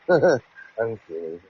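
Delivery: usual speaking voice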